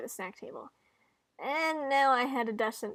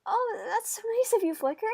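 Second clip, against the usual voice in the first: high-pitched, delighted appreciation; sweetly grateful, voice lifted high; touched thanks; 10 semitones higher, 4 dB louder than usual. Acted. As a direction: coo it in a raised pitch. Touched by promise of a gift.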